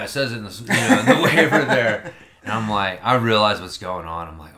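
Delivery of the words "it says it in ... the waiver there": sheepish laugh. Retold or imitated. Laser tag worker caught out.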